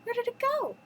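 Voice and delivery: high, dismayed storybook question; acted high-pitched bewilderment; Belle crying out. A narrator's reading. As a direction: wonder aloud in a high voice. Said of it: "she cried".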